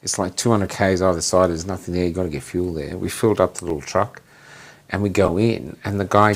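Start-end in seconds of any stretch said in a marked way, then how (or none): none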